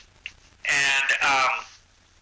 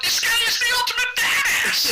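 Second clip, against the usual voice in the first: high, laughing awe; laughing amazement. Retold or imitated. Telling the host how he marveled, high laughing awe. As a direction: marvel at him through a laugh. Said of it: "and I go".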